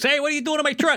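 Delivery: high squeaky yell; high mock yell. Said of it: "leans out and goes".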